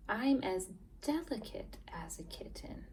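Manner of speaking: soft whispered line; delicate whisper. Shown as a demonstration. Teacher reading to kids, a delicate whisper. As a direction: breathe it gently, delicately.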